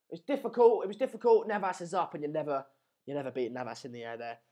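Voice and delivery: usual speaking voice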